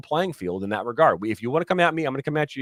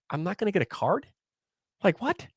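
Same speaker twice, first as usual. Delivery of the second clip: mock incredulous, pitched way up; retold disbelief; 10 semitones higher, 4 dB louder, faster than usual. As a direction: imitate a coach stunned and baffled, voice climbing. Coach stunned at getting no card.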